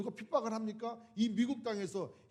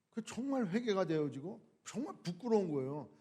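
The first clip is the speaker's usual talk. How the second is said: ordinary talk, nothing marked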